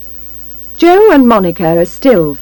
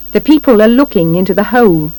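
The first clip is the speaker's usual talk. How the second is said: usual speaking voice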